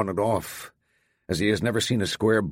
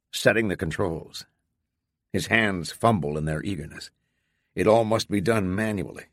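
plain reading voice

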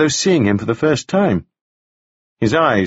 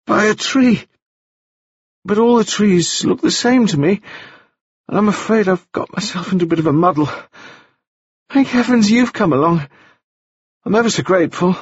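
put-on much higher, bright boyish voice; high bright young gentleman; 10 semitones higher than usual. A narrator's reading. Wounded Staines greeting Taufari, high and bright. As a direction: lift it up high and bright like a dazed young toff. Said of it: "speaking in a voice that was high and very bright".

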